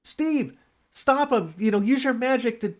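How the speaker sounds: high, pressing warning; mock urgent call; urgent alarm. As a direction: urge Steve to stop him. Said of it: "He said".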